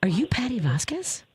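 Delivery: breathless whispered question, starstruck; awed whisper. Retold or imitated. Waiter recognizing the host, whispering in awe. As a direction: hush it like you can't believe who's in front of you.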